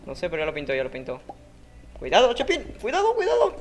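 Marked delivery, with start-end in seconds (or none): 2.0-3.5 high, loud panicked no no no; panicked screaming. Real, not acted. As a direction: scream in panic as you lose. Panicked warning then loss.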